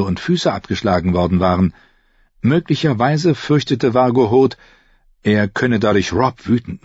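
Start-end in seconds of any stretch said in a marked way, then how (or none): none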